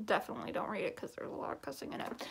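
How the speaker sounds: fast, hushed whisper; quick conspiratorial whisper; whispered warning. Real, not acted. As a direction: whisper it as a cheeky aside. Stage aside warning about cussing.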